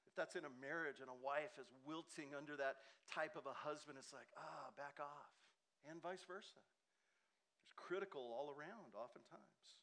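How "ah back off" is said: ordinary delivery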